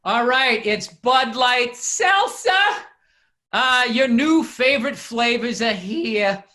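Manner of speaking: big, drawn-out announcer opening; sponsor ad read. Acted. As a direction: kick off the ad slowly, stretching the brand name.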